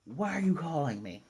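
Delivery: put-on indignant character voice; indignant demand. Becky reacting to Anya calling her, mock indignant. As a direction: act out an affronted look in the voice. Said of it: "I love her look. Just like".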